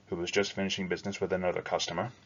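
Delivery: ordinary delivery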